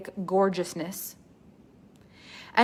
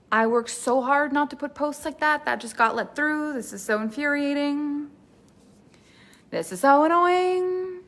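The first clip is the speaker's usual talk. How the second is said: higher, exasperated rant, a touch hammed-up; mock indignant client; 5 semitones higher than usual. Client fumes about a post that broke the rules, higher and indignant. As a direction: play the fed-up rule follower. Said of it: "are watching this going".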